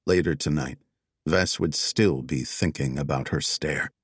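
ordinary delivery, nothing marked

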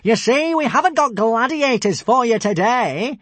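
high, loud, gloating announcement; shouting down. A narrator's reading. Alvin reveals the surprise from his balcony, shouting down. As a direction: crow it from a safe height. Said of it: "Alvin shouted down".

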